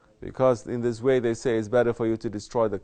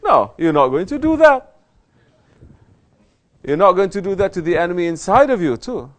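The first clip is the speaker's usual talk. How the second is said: higher, louder sermon delivery; forceful preaching; 7 semitones higher, 8 dB louder than usual. Acted. Sheikh rebuking murids about training, forceful preaching. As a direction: drive the correction home with force.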